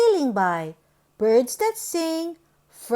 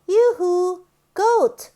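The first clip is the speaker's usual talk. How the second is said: high, louder sing-song call; 5 semitones higher, 5 dB louder than usual. Acted. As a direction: call over to the goat. Calling out to goat.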